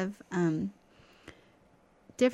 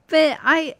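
ordinary talk

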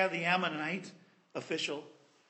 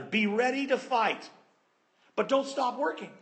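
rallying call; 5 semitones higher, 4 dB louder than usual. Retold or imitated. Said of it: "And he said".

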